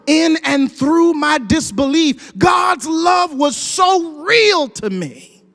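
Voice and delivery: slow preacher's crescendo, rising in pitch; preaching, building. Real, not acted. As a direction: build each phrase to a high, emphatic peak.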